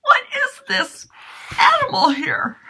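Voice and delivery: very high, loud shriek of surprise; startled squeal. Pretend. Puppet discovers an animal on stage, startled high shriek.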